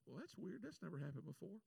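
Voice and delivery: understated 'huh, that's odd'; mild puzzlement. Retold or imitated. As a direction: shrug it off quietly to yourself. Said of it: "And I was like".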